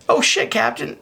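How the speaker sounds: high, pleading rookie voice; flustered kid cop begging; nervous young cop. Acted. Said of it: "your nervous cop".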